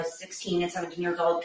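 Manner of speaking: ordinary delivery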